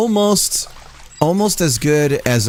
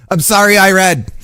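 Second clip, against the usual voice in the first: much louder, higher, laughing through I'm sorry; laughing apology; 8 semitones higher, 10 dB louder than usual. Real, not acted. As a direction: apologize while cracking up.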